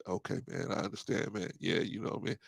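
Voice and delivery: hushed, very soft near-murmur; barely voiced, mild-mannered agreement; quiet voice. Host shows the quiet phone voice Rico lacks, hushed. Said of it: "a quiet voice like".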